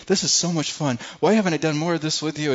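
put-on a father thrilled like a teenage boy; dad on the boat, overjoyed at fishing with his son; delighted dad.

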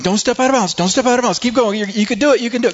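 high-pitched, excited cheering on; excited fan urging. Retold or imitated. Fan pleading with the player on screen, high and excited. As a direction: urge the runner on in a high, tense voice. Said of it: "I'm excited".

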